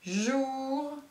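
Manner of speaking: "Journal" drawn out, jouuur... nnnal; soft, slow "Journal", each syllable held; slowly split syllables. Shown as a demonstration. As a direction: stretch both halves of "Journal" with a long pause between. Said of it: "Où se trouve le bruit du jet d'eau?".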